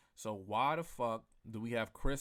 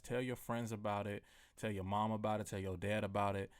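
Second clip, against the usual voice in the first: ordinary talk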